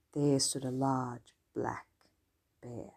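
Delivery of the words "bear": usual speaking voice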